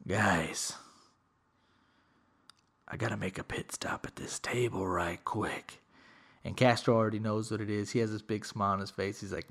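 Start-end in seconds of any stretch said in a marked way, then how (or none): 0.1-5.8 hushed, low aside to the guys; low conspiratorial hush; sly whisper. Retold or imitated. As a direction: whisper it like a sneaky plan. Sneaky aside to his buddies.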